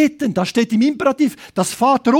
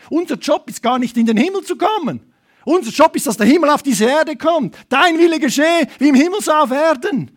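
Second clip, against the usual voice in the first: high, ringing proclamation; preacher building in fervour; impassioned preaching; 6 semitones higher, 3 dB louder than usual. Real, not acted. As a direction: declare it fervently to the congregation. Preaches at full force.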